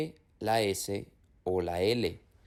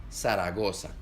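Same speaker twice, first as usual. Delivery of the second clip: Zaragoza with every z as a plain s, Latin American way; 3 semitones lower than usual. Shown as a demonstration. Teacher demonstrates the Latin American s in Zaragoza. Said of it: "en Latinoamérica suena como S".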